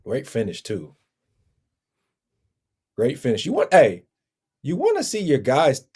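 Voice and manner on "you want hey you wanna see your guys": pitched up and louder, hyped; excited. Retold or imitated. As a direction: get fired up about the hit. Hyped about teammates celebrating.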